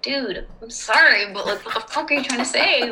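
amused mock exasperation; exasperated retort. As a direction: act out a fed-up comeback, half laughing. Retells exasperated reply to a customer.

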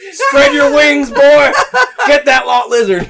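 loud, high Southern dad holler; hammed-up Southern send-off through laughter; Southern accent. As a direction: bellow it as a mock Southern dad. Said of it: "everyone's a southern accent".

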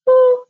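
short, high-pitched "woo"; imitated tic whoop. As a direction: mimic a child's sudden vocal tic. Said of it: "And he'd go".

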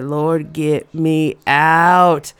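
low, slow pleading whisper-prayer; scared prayer. Retold or imitated. Girl praying to escape the driver, low slow plea. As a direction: beg the Lord to escape.

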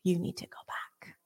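unvoiced, gentle hush; gentle whisper. Jesus gently urging her, whispered. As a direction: say it gently, barely voiced. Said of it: "Jesus very gently said".

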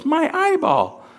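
very high, drawn-out cartoon kid voice; silly high-pitched cry; Ralphie. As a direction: squeak it high and slow. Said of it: "Ralphie with this high voice goes".

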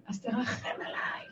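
slow, whispered begging; hushed, slow supplication; plea for mercy. Shown as a demonstration. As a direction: plead in a drawn-out, breath-only whisper. Demonstrates pleading to God for mercy.

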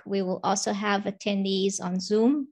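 usual speaking voice

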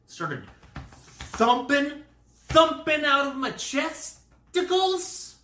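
high, drawn-out comic alarm; hammed-up racing-heart panic; dramatized alarm. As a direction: stretch it out, high and loud.

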